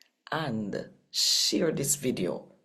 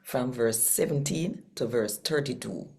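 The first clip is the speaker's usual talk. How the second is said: steady, even Bible reading in Patois; scripture reading; 4 semitones higher than usual. Acted. Host reading Acts to listeners, measured scripture narration. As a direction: read the passage as a daily devotion. Daily Jamaican New Testament reading.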